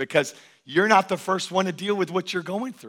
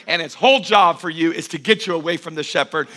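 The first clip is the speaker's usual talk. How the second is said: usual speaking voice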